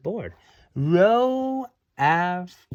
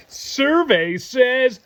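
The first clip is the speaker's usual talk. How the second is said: slow, loud game-show announcer call; drawn-out game show reveal, louder and higher; game show host; 7 semitones higher, 6 dB louder, much slower than usual. Pretend.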